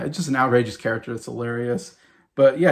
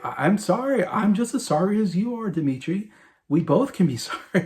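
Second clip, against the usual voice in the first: higher-pitched imitation of an apologetic leader; President Merkin; 8 semitones higher than usual. President on the phone to his Russian counterpart, imitated placating apology. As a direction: plead sorry in a lifted, placating phone voice. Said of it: "you know, he's like".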